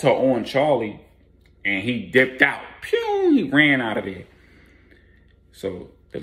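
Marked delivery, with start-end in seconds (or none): 2.8-3.3 very high "pew" whoosh of someone bolting; a quick, squeaky exit noise; zip sound effect. Retold or imitated. Bleak dashes out.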